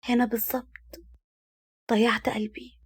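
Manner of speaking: a hushed sad thought spoken inwardly; sad inner whisper. A narrator's reading. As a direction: breathe it out as a private grief. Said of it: "وقالت في نفسها بحزن".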